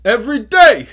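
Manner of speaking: high, loud Boston shout; over-the-top Boston impersonation; [name] as a Boston guy. Acted. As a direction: belt it out in a bad Boston accent. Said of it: "[name] impersonation as a Boston guy".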